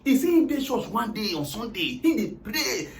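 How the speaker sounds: normal talking voice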